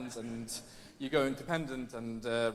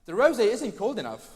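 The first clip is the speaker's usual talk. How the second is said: loud, high, laughing posh moan; posh complaint; 6 semitones higher, 6 dB louder than usual. Retold or imitated. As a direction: complain like a pampered festivalgoer. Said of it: "And then I was like".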